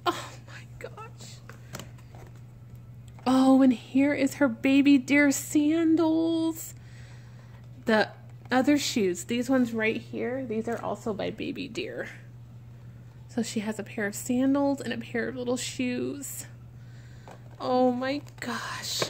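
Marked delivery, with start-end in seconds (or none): 0.1-1.4 hushed, rising in wonder; awed whisper. Real, not acted. Awed by baby outfit. 17.6-19.0 awed whisper. Real, not acted. Awed by shoes.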